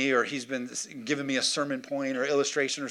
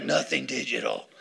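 mock paranoid tech-refuser voice; tinfoil-hat crank. Pastor teasing congregants who still own VHS, mock crank. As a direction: put on a conspiracy crank. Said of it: "some of you are like".

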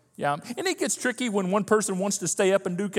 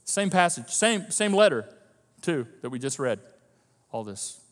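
normal talking voice

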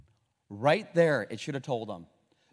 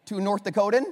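usual speaking voice

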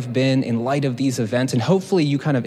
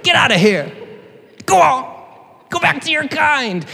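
much higher, louder, shrill shoo; 13 semitones higher, 3 dB louder than usual. Pretend. Naomi shooing Ruth away, hammed-up shout. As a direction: chase her off like a wild animal. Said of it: "how I'm starting to hear Naomi".

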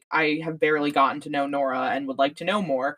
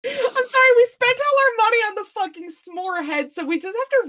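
much higher, laughing exasperation; mock outrage. Real, not acted. Podcaster ranting at the show's writers, high mock outrage. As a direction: rant at the writers in fake fury.

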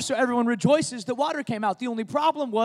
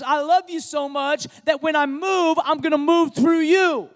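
higher and louder impassioned preaching; 5 semitones higher, 4 dB louder than usual. Acted. Pastor telling the church God works through people, preaching higher and louder. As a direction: press the point home with fervor.

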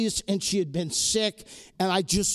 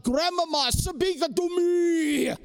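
urgent pleading, pitched up high; desperate plea on the phone, voice pushed high; 8 semitones higher than usual. Retold or imitated. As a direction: beg grandma to speak to you. Begging crying grandmother to speak.

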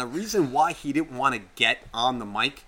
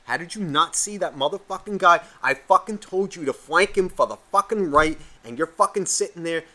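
louder, heated mock gamer tirade; retold angry rant; 4 semitones higher, 5 dB louder than usual. Player curses out teammate over voice chat, retold rant. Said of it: "you hear my voice coming in".

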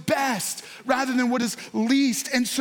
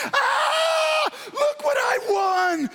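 ecstatic lotto winner; 13 semitones higher, much slower than usual. Pretend. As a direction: celebrate like you hit the jackpot. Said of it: "running around your house going".

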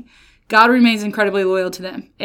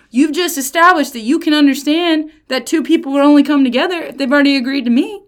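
ordinary talk

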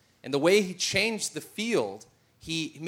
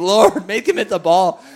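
pleading prayer; 3 semitones higher, 10 dB louder than usual. Retold or imitated. Dad begging God at game.

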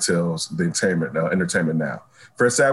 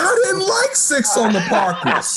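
laughing; slower than usual. Real, not acted. Laughing through a mock complaint.

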